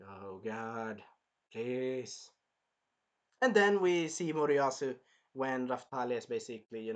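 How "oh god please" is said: low, soft pleading groan; quiet dread. Retold or imitated. Viewer dreading the princess's scheme, softly pleading. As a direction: mutter a despairing plea.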